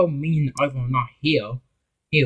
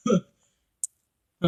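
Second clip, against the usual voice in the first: high bright laugh; a quick happy laugh, pitched up; shared laugh between friends; 9 semitones higher than usual. Acted. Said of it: "Philip also laughed".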